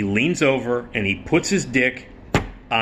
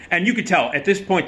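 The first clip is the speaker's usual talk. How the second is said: ordinary talk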